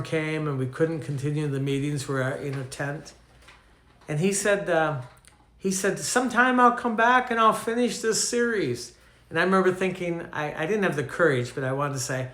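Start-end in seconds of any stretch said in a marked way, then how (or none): 6.0-8.9 imitating southern accent. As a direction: put on the preacher's borrowed Southern US accent. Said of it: "he had this southern accent".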